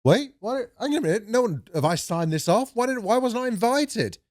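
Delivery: a hint of an offended musician, high. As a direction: play a miffed rock star left out. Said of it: "He was like".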